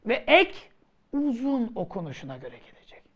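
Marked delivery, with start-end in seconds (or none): 1.1-3.0 fast hushed whisper, no voice; whispered rule. Shown as a demonstration. Teacher repeats the rule.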